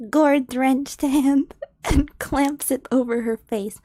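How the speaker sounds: ordinary delivery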